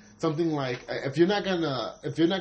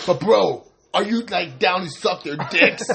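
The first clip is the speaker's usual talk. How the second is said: louder, slowed mock bro interrogation; mock bro fan; 3 semitones higher, 6 dB louder, slower than usual. Teasing metal fans as pushy bros, loud mock voice.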